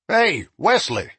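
calling across the room. Acted. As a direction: call out to Wesley. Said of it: "the deputy called".

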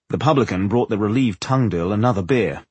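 usual speaking voice

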